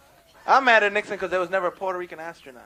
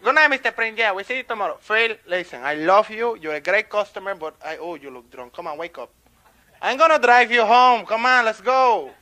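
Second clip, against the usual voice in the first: Pablo; 4 semitones higher, 5 dB louder than usual. Acted. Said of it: "Pablo's starting to close up".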